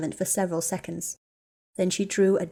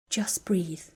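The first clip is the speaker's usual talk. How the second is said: soothing; 4 dB quieter than usual. A narrator's reading. Hermione calming a panicking friend.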